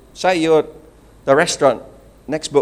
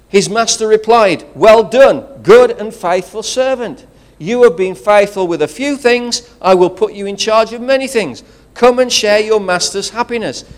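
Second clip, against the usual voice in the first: loud, higher, proclaiming the master's praise; preaching scripture aloud; 5 semitones higher, 6 dB louder than usual. Acted. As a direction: read it like a pulpit proclamation. Scripture read with preaching force.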